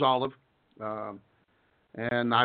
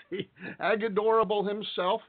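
laughing; 7 semitones higher than usual. Real, not acted. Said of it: "laughing intro of a guest".

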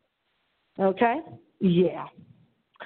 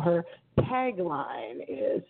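ordinary talk

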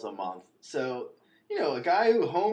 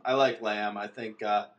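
usual speaking voice

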